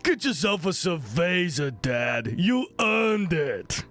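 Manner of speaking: drawn-out cartoon buff-boy voice; Ha Ha You Clowns boys. One of the boys urging Dad to order, slow cartoon voice. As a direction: stretch it out like a big cartoon boy. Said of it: "one of the boys says".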